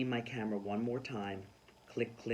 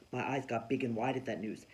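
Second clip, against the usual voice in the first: ordinary delivery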